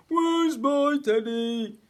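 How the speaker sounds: loud, high, drawn-out whine; big whining bear. A narrator's reading. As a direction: wail it slowly like a great big bear. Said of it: "A great big voice whined".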